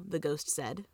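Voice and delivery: plain reading voice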